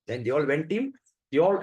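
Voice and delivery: ordinary talk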